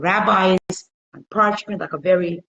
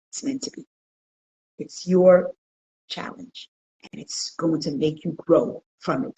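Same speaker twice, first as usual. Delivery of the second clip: ordinary talk, nothing marked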